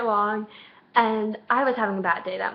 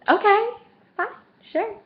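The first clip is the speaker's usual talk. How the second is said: eager agreement; 11 semitones higher, 4 dB louder than usual. Retold or imitated. Acted reply to lap invitation.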